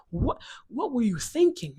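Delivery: high, drawn-out exasperation; exasperated scolding. Acted. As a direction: scold in slow disbelief. Mock-scolding dad in a sermon.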